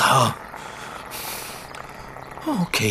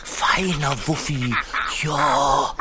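talking to a dog; 3 semitones lower, much slower than usual. Acted. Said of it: "so albern".

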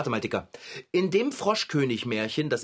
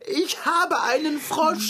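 much higher, slow dazed wail; 12 semitones higher, slower than usual. Acted. Dazed wail at the cat friend, still in shock. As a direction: stretch it out in disbelief.

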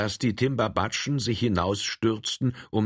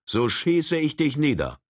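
raised, menacing warning; threatening; 6 semitones higher than usual. A narrator's reading.

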